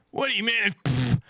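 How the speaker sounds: high scoffing friend brushing it off; mock dismissive friend. Imitating Marco telling me there's no droplets, high and scoffing.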